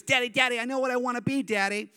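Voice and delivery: put-on high, eager little-kid voice; five-year-old daughter. Young daughter announcing her ambition to dad, high childish excitement. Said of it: "When she was five, she goes".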